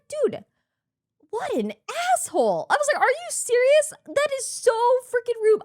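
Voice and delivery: high-pitched retold outrage; livid. Host retelling her anger at Ludwig skipping to stream, livid. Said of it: "I was livid".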